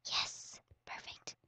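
whispered yes, perfect; unvoiced pleased whisper. Real, not acted. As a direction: breathe it out to yourself. Whispered to self.

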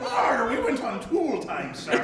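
slow, loud Irish pirate; hammed-up pirate brogue; Irish Tim Allen. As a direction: growl it out like a comic pirate. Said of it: "He's an Irish pirate".

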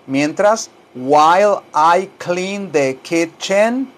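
slow, drawn-out English for repeating; slow teaching model. Shown as a demonstration. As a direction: model the sentence slowly, word by word. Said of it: "tal como lo oye que yo lo digo". (inglés lento para repetir)

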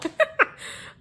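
a high burst of laughter. Real, not acted. Laughing at her own joke to viewers, high giggle.

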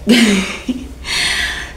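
winded laugh between breaths; breathless laugh. Real, not acted. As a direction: laugh while panting. Breathless after burpees.

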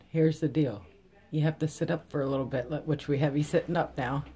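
usual speaking voice

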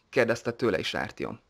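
ordinary delivery

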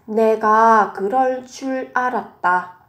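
ordinary talk

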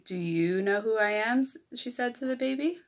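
lively picture-book read-aloud; reading to children. A narrator's reading. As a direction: read it like story time for little kids.

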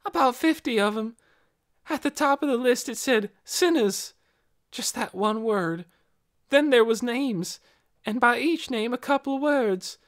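lightly voiced young girl, confiding; a touch of a guilty girl. Girl character voiced throughout.

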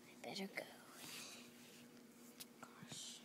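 soft unvoiced whisper. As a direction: whisper so nobody hears. Sneaking off, whispered.

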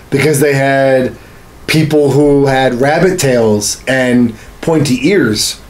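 ordinary talk

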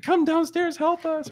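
high, urgent begging; frantic plea. Retold or imitated. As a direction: plead high and desperate for help. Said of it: "And she's like".

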